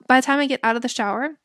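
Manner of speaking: normal talking voice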